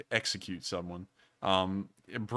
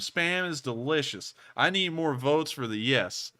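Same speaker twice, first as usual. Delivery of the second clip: sales pitch; 4 semitones higher than usual. Real, not acted. Host urging viewers to vote yes for spam, playful sales pitch. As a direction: sell the audience on it like a TV pitchman. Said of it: "I'm giving a sales pitch".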